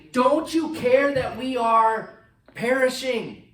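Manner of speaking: loud, high, slow desperate plea. Retold or imitated. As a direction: beg a sleeping master for help. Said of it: "they wake up Jesus and they say".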